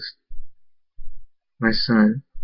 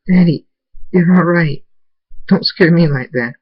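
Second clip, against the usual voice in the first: put-on high, louder upset child voice; tearful child; 8 semitones higher, 4 dB louder than usual. A narrator's reading. Abel in tears to his daddy, tearful child. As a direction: voice a crying child scolding a parent. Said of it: "tears go down both our faces".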